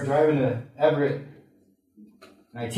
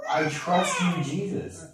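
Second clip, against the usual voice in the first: higher, strained mock yell; retold yell; 3 semitones higher, slower than usual. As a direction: act out yelling at the windshield, held back. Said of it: "I'm yelling it at the windshield".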